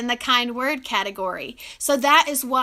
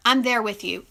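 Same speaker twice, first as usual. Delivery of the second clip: ordinary talk, nothing marked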